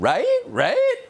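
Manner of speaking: high, loud rising "Right? Right?"; mock the upspeak of a smug pundit; imitating a sing-song cable-news woman; sing-songy mock voice. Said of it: "talking in a sing-songy voice, inflection at the end".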